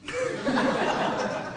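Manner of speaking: a sudden high laugh; high breathy laugh. Real, not acted. Laughter after a joke.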